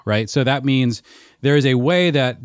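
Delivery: usual speaking voice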